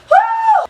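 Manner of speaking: loud, high "woo" to let off steam; high whoop. Real, not acted. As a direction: whoop it out, worked up. Letting off steam after reliving fight.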